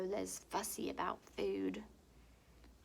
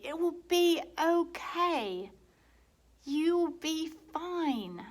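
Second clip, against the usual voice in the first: slow, higher, louder soothing; reassuring; 9 semitones higher, 9 dB louder, slower than usual. Acted. Brother calming nervous Lola, slow reassurance. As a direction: comfort her slowly.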